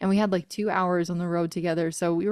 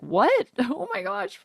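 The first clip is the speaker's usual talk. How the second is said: surprised; 8 semitones higher, slower than usual. Retold or imitated. Stunned by God's request.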